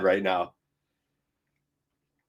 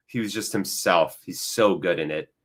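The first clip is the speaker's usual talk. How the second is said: ordinary talk, nothing marked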